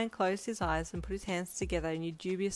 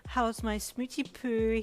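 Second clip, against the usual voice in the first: higher, slow, cooing singsong; singsong greeting; 5 semitones higher, 4 dB louder, slower than usual. A narrator's reading. Brian greets Jasmine with a kiss, singsong. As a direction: coo it like pet talk. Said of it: "he sung as he kissed her".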